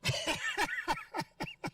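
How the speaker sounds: high squealing laugh. Real, not acted. Wild laugh after punchline.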